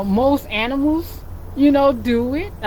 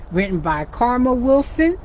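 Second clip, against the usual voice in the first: usual speaking voice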